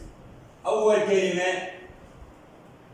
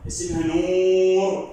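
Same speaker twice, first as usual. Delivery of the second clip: very slow and louder; slow model reading; 5 dB louder, much slower than usual. Shown as a demonstration. Teacher models the name نور loudly for the child. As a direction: stretch out نور clearly. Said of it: "teacher models name for pupil".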